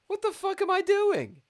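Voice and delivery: very high, loud squeal of disbelief; exasperated outburst. Real, not acted. Player berating own terrible move, high exasperated squeal. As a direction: squeal it loud at yourself in frustration.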